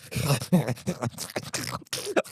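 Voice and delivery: soft, rasping dog panting; frantic mock panting; imitated hyperventilating. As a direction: imitate an overexcited dog's wheezy breathing. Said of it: "hyperventilating 'cause he gets so turned on".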